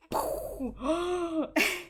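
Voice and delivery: high, sudden intake of breath; sharp gasp. Real, not acted. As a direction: gasp as if caught by surprise. Reacting to a shock in the show.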